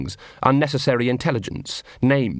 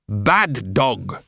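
tag; 2 semitones lower than usual. A narrator's reading. Dog imitates human scolding command.

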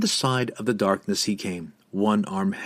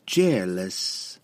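purring; 3 semitones lower than usual. Acted. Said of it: "while she purred her name".

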